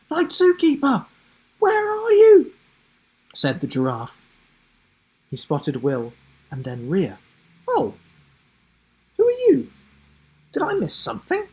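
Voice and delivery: a bouncy, high cartoon giraffe calling out. The giraffe Sam bursting through trees calling for Night Zookeeper, high-pitched.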